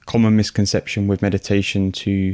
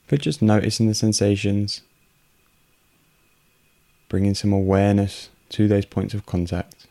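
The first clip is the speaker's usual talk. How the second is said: unhurried meditation voice with long pauses; guided meditation. Real, not acted. Host guiding listeners through a breathing meditation, calm and unhurried. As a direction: guide calmly in short, evenly pitched phrases, leaving space for breath.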